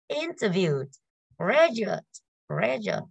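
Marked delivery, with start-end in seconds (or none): 1.4-3.1 "graduate" with a strong "gra" and falling end; stress on first syllable. Shown as a demonstration. Teacher repeating the word for students, "graduate" stressed on "gra". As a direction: hit "gra" in each "graduate". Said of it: "âm số một đúng không ạ?".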